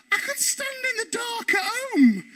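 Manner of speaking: high mock-deadpan grumble about the dark; sarcastic complaint. To Ed in the queue, high sarcastic grumble. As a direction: sneer at the dull ride.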